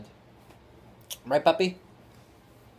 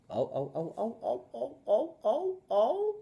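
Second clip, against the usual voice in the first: each oh climbing much higher; rising oh oh oh; 8 semitones higher, 7 dB quieter than usual. Real, not acted. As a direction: let the ohs climb up and up. Cheering baby, rising.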